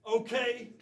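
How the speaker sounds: ordinary talk, nothing marked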